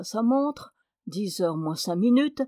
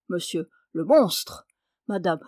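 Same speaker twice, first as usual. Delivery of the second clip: ordinary talk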